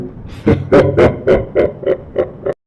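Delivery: high evil cackle; long wicked hag cackle; witch cackle. Acted. Witch laughs at them.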